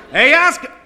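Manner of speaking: high, very loud holler; full-force exasperated call; yelling. Acted. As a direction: shout the name up to the roof. Fireman yells up again.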